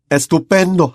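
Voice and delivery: calling out praise across the crowd; shouting. A narrator's reading. Seamus calls to Harry, lively shout. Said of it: "gritó Seamus Finnegan".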